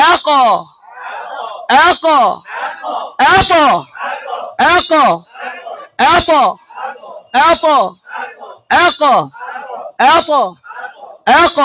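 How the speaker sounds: এক with an upward bouncing release; qalqala demo. Shown as a demonstration. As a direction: bounce each letter upward. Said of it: "কলকলার গতিটা জবরের দিকে যাবে".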